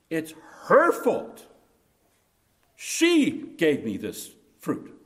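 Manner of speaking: slow, accusing, leaning on her; resentful blaming. Retold or imitated. Adam blaming his wife before God, slow and accusing. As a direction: point the finger at her, drawn out. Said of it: "words of a man whose affections have died".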